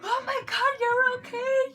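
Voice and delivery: high, loud cry of relief; acted relief. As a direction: exclaim it as if finding someone lost. Acted relief at finding lost sister.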